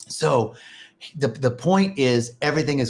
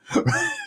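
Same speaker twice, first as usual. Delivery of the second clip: sudden high-pitched laugh. Real, not acted. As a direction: burst out laughing at the joke. Laughs at the punchline.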